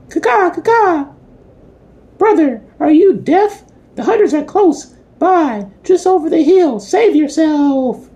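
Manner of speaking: very high screeching crow voice; shrill screeching bird woman. A narrator's reading. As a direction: squawk it out like a frantic crow.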